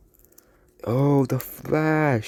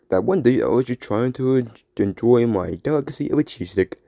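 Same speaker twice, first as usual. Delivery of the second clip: normal talking voice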